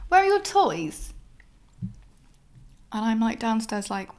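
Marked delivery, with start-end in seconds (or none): 0.1-1.1 mock eager date. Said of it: "He goes to me".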